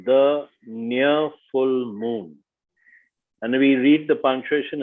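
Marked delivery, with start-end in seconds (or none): 0.1-2.4 "the near full moon" slowly, each word its own beat; very slow, separated "the near full moon"; counting out syllables. Shown as a demonstration. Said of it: "So five syllables".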